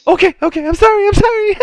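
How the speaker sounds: loud, very high whimpering apology; frightened barmaid. Acted. Barmaid fleeing an angry customer, tearfully panicked. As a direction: wail apologies in a panicked falsetto. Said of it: "And the barmaid's".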